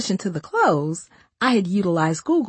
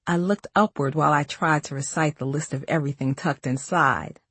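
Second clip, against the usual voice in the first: plain reading voice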